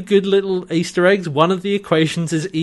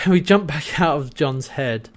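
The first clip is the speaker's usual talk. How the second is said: ordinary delivery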